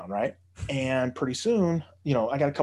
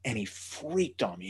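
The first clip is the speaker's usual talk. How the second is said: ordinary talk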